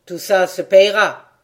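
a loud, sneering threat with a snicker. A narrator's reading. Bailiff threatening the father, loud and sneering. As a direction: spit it out with a mocking snicker. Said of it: "dit l'autre en ricanant".

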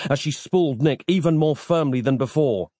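ordinary delivery, nothing marked

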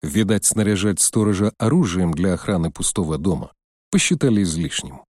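ordinary delivery

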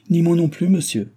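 high, loud and firm refusal. A narrator's reading. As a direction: shut the door on him, resolute. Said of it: "avec beaucoup de fermeté".

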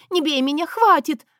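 high, loud cry of staged terror; feigned desperate plea. A narrator's reading. Wife fakes fear to frame her husband, desperate pleading. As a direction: beg loudly like a battered wife. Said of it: "взмолилась несчастно".

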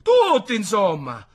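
loud, high, emphatic list; impassioned retort. A narrator's reading. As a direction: hammer out each word in exasperation. Apothecary's heated answer in argument.

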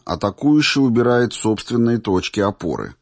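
plain reading voice

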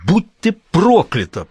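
high, loud drawn-out curse; cursing shout. A narrator's reading. Prince shouting a curse at the maid, loud and slow. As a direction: hurl the curse at her slowly. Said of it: "прокричал он".

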